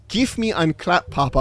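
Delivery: slow, hammed-up fake Dutch; lightly mock a Dutch phrase, slowly; playful fake Dutch accent, slow. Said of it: "oh, yeah, it was".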